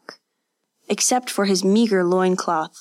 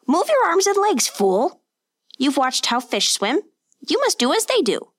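higher, harsh teacher's bark; scolding master; 8 semitones higher than usual. A narrator's reading. Master scolding the boy in the water, high and harsh. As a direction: berate the clumsy pupil from the shore.

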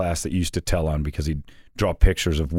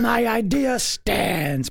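very high, slow mock proclamation; hammed-up high voice, drawing out every word; indignant Brady; much slower than usual. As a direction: stretch it out in a high imitation of a stubborn colleague. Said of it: "And Brady decided".

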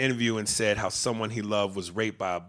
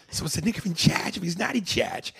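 higher-pitched mock Boston accent; 8 semitones higher than usual. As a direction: do a Boston accent, dropping the r in "charge". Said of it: "in charge with that accent".

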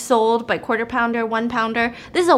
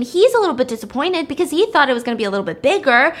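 ordinary delivery

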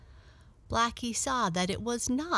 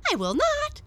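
very high, loud defiant yelp; dog refusing the cat, shrill and loud; defiant refusal; 6 dB louder than usual. Acted. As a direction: bark back a flat no.

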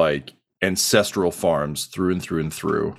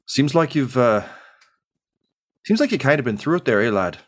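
a touch higher, gentle dad; lightly voiced dad; 5 semitones higher than usual. Dad sadly notices his son's eye patch. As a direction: lightly voice the sad father. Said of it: "nods and says".

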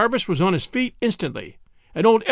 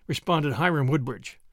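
plain reading voice

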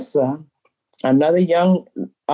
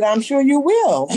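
laughing punchline; 8 semitones higher than usual. Real, not acted. Owner delivering her comeback to the customer, laughing. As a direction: deliver the line as laughter breaks through, pitched up.

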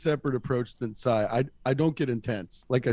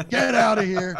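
higher, louder imitation of a fed-up boss; mock angry dismissal; 5 semitones higher, 4 dB louder than usual. Boss firing the storyteller, imitated angry yell. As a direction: raise the voice as if firing someone.

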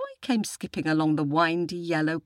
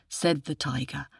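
ordinary delivery, nothing marked

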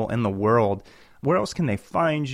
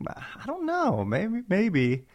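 unsure; 8 semitones higher, 4 dB quieter, much slower than usual. Retold or imitated. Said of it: "And I'm like".

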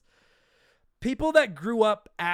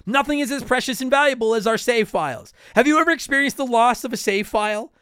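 upbeat, louder sponsor read; 5 semitones higher, 9 dB louder than usual. Acted. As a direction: sell it like a podcast ad. Host reads sponsor ad.

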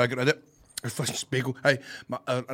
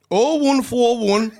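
loud, slow, raised Geordie digits; attempted Geordie accent; 9 semitones higher, 9 dB louder, much slower than usual. Shown as a demonstration. Guest reads the taxi number on request, attempted Geordie accent. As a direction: call the number out in bad Geordie. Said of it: "What's the number in a Geordie accent?".

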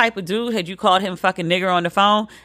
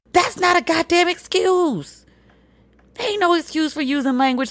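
indignant; 10 semitones higher than usual. Real, not acted. Indignant retort.

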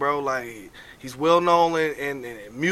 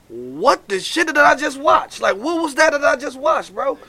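high, slow, stunned disbelief; what did I just see, slowly; 9 semitones higher, 4 dB louder than usual. Real, not acted. Shocked by the collection call.

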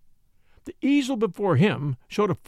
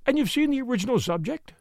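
timid; 8 semitones higher than usual. A narrator's reading. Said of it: "she said timidly".

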